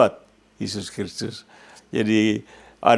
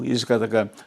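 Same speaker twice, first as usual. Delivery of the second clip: ordinary talk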